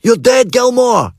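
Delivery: high, slow mock yell; shouted threat. A narrator's reading. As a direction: bellow after him as a lad. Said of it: "Dom shouted after me".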